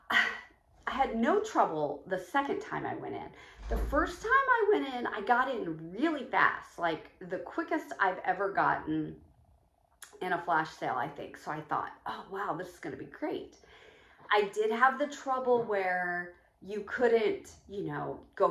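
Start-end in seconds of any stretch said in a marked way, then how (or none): none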